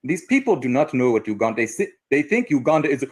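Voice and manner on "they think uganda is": ordinary talk, nothing marked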